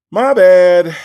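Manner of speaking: sudden loud my bad; loud mock apology. Host blurts a loud apology to chat.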